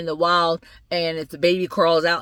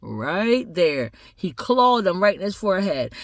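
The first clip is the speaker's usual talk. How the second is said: usual speaking voice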